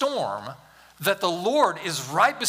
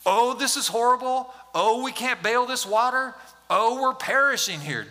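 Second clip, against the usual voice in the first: raised, whiny panic of scared disciples; mock panic; 5 semitones higher than usual. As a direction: play the frightened disciples, a bit hammed-up. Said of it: "So they're freaking out".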